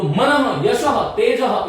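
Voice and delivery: मनः, यशः, तेजः each closed with an echoed "ha"; visarga demonstrated. Shown as a demonstration. Teacher models for students, each word ending "-aha". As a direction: sound out the final breath in मनः, यशः, तेजः. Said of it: "इसको ऐसे बोलना".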